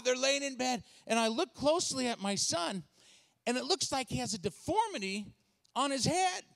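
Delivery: normal talking voice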